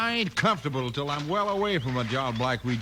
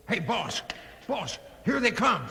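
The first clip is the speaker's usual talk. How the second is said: alarmed warning; 7 semitones higher, slower than usual. Acted. Alarm, lawmen arriving.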